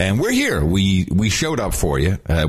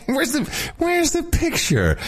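squeaky, much higher mocking echo; slower than usual. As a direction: parrot it back in a high squeak. Mock-echoes the question squeakily.

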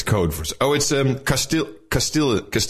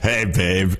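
low, put-on smooth greeting; mock flirting; 4 semitones lower than usual. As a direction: tease with a cheesy come-on. Repeats the mock pickup line.

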